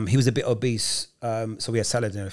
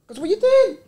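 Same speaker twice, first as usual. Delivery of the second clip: panicked question; 6 dB louder than usual. Retold or imitated. Panicking teen quoted.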